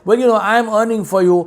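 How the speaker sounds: high, fast, mocking whine of an excuse.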